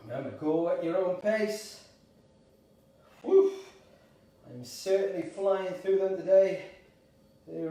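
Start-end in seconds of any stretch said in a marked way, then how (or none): 3.2-3.5 high, loud whoop of effort; effortful woo. Real, not acted. Coach mid-exercise, short loud woo of effort.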